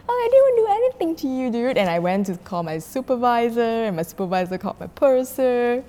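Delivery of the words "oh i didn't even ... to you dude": high, loud, very fast mock wail; exasperated protest. Attendant protesting to abusive customer, high and exasperated. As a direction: blurt it out as if wronged.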